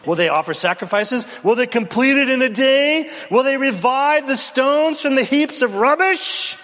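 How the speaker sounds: mock them, climbing as you go; stinging mock. Sanballat taunting the builders, rising sneer. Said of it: "Can you hear the stinging mocks?".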